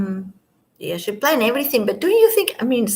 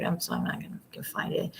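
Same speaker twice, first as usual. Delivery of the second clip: mutter; 13 dB quieter than usual. Real, not acted. Mutters aside to herself.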